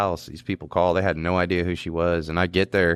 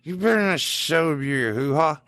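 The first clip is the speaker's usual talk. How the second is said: put-on drunk jealous boyfriend; 7 semitones higher than usual. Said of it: "So he's like".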